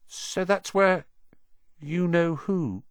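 high, hushed and hesitant; awed boy whisper-question. A narrator's reading. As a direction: ask in hushed awe. Ron asks in awe.

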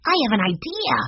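put-on high-pitched little-boy voice; excited young Benny. A narrator's reading. Benny speaking up as Sim goes away, excited. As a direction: announce it high and eager.

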